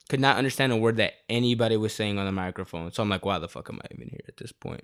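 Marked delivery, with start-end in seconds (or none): 3.2-4.8 under-the-breath grumble, nearly whispered; fed-up whisper to himself; muttered aside. Retold or imitated. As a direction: mutter it to yourself, bored and fed up. Can't hear the ceremony, fed up.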